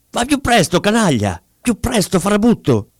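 high, angry berating; furious yelling. A narrator's reading. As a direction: scream abuse at the driver. Pummels driver while yelling.

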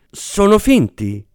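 high, slow, sheepish blurt. A narrator's reading. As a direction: let it slip out. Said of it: "gli uscì all'attore". (detto di getto, voce acuta)

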